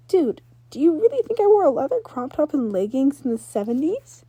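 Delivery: put-on confident girl explaining her gear; Ember's voice.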